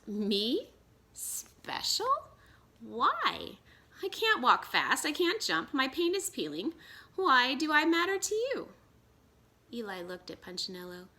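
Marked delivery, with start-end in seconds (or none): 0.1-8.7 high, loud, incredulous; disbelieving protest. Acted. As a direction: pour out every reason you're not special.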